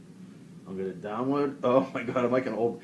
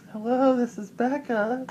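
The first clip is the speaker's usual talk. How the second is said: fake Becca voice; 9 semitones higher, slower than usual. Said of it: "answer the phone as different people".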